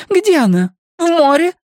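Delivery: high, loud demanding woman; agitated high-pitched interrogation; sharp questioning. A narrator's reading. Angry woman interrogates the man.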